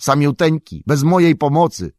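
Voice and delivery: loud, aggrieved outburst; indignant exclamation. Acted. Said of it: "zawołał sam".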